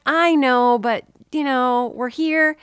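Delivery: pleading excuse. Retold or imitated. Imitated partner talking wife into settling for pizza, pleading.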